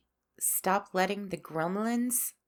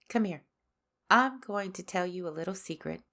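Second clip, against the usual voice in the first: ordinary talk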